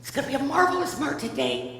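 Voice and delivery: lightly mimicked chirpy dad wake-up call; singsong morning cheer, lilting upward; cheery. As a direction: play a relentlessly upbeat dad. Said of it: "just cheery as all get out".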